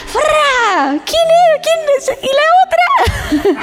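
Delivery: husband spotting his wife without makeup, mock-startled shriek, then a laugh. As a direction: squeal in fake alarm at a stranger. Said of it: "Llegan a su casa".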